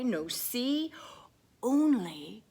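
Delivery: ordinary delivery, nothing marked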